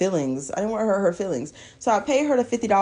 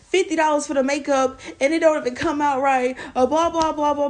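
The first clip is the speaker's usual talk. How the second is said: ordinary talk, nothing marked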